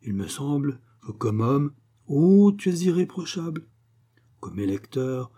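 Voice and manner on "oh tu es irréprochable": higher, louder gushing praise; mock flattery. Wife parroting praise of her husband, mock flattery. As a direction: gush with exaggerated admiration. Mimics others' praise, mocking.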